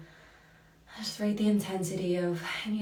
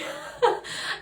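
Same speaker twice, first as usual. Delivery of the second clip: breathy laugh of being moved; emotional laugh; 3 dB louder than usual. Real, not acted. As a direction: let out a moved little laugh. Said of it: "about to cry".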